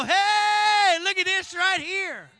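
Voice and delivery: excited hey, pitch up, then settle; mock excited shout. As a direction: shout it out like walking into a friend's house. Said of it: "walk in your new home and go".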